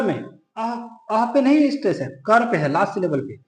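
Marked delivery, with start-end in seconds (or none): none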